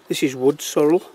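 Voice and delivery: ordinary talk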